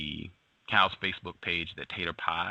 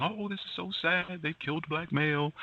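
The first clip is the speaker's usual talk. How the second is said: much higher, mock-sad whiny voice; 11 semitones higher than usual. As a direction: sneer through a fake tearful lament. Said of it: "They're crying".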